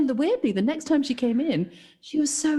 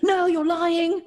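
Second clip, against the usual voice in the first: high, angry accusation; accusing; 7 semitones higher, slower than usual. Retold or imitated. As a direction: fling the accusation back. Customer accusing in argument.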